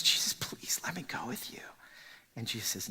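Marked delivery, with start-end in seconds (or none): 0.0-1.6 hushed, pleading whisper; whispered plea. Acted. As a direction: breathe the plea. Voicing the man's plea.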